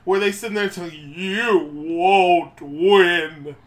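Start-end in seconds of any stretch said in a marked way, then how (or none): 1.1-3.6 very slow, stretched-out mimicry; spot-on movie impression. Quoting what they tell you at Medea's reunion, drawn-out impression. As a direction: draw every word out in mimicry. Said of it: "That sounded like she was here".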